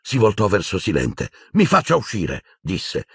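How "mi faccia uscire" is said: higher, shaking command; trembling demand. A narrator's reading. Boy demanding to leave, higher and tense. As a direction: demand it, barely holding on. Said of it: "Tremava da capo a piedi".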